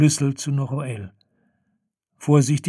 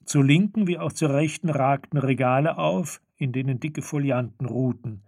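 ordinary delivery

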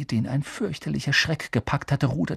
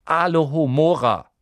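usual speaking voice

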